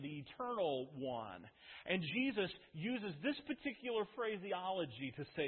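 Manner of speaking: ordinary talk, nothing marked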